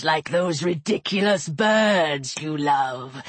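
deep, slow, amused serpent villain; Apophis. A narrator's reading. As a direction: toy with a small victim, low and drawn-out. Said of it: "Apophis's voice simply moved".

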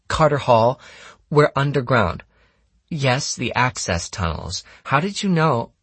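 put-on high girl's voice, answering questions; girl on phone. Anna reports a theft to the operator, high-voiced. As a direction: pitch it up, answering an unheard operator.

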